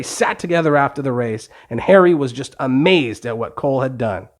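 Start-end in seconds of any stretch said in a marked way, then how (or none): none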